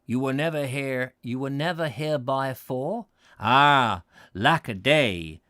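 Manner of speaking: a put-on rustic West Country accent, slowed; old Devon pedlar, West Country accent, lightly done. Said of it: "I don't know what accent I've gone into there".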